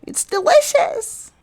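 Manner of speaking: very high, slow gushing squeak; squeaky delighted girl. Acted. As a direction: squeal it like a sweet cartoon girl. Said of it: "talks with her mouth full".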